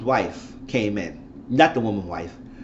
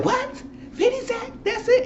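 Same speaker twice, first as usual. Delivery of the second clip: much higher, shocked squeals of disbelief. Retold or imitated. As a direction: react as if the news just landed. Friends react to baby news.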